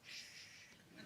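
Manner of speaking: smiling, whispered shush; gentle hushing. Acted. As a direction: hush him softly and drawn out. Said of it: "looking over and smiling and going".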